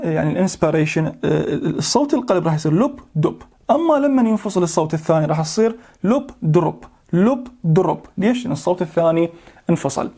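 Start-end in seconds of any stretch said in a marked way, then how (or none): 2.7-3.4 even lub dub, with the second sound said as one beat; plain lub dub demo; normal heartbeat imitation. Shown as a demonstration. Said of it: "فراح أمثل لكم إياها". 6.0-8.0 lub drub, with the second sound rolled into a split beat; split heartbeat imitation. Shown as a demonstration. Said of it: "فراح أمثل لكم إياها".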